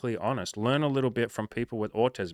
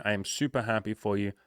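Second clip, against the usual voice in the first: ordinary delivery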